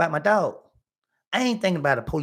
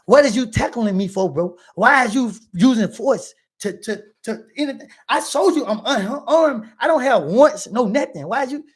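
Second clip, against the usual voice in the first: retold protest; 5 semitones higher, 5 dB louder than usual. Retold protest to tackling cop.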